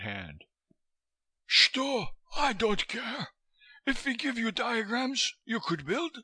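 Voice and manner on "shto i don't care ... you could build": very high, impatient wheezy Russian; wheezy foreigner, curt and dismissive; Koitska, impatient. A narrator's reading. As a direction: cut him off in a high, accented voice. Fat Koitska interrupts Chandler.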